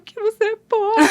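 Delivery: high, tearful mock child voice; imitating a crying daughter. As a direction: sob out the answer like a small child.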